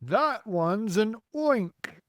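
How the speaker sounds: high, drawn-out cry of alarm; mild scream. A narrator's reading. Fire chief spotting the grasshopper, mildly screaming. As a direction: cry it out slowly, short of a real scream. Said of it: "screamed the head of the fire department".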